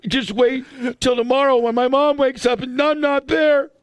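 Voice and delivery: high-pitched blubbering child, hammed up; imitated tearful wail; mock crying. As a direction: sob it out like a runaway kid vowing revenge. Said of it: "I'm crying".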